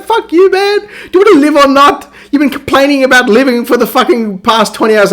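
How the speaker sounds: high, heated mock argument; indignant outburst. Player arguing with Silverhand about living, indignant rant.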